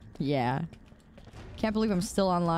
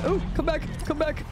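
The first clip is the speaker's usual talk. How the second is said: teasing call; 8 semitones higher than usual. Real, not acted. Calling an enemy back in game.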